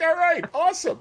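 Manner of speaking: high fanboy squeal; mock-giddy, very high reply; starstruck. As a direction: squeak it out, thrilled and overawed.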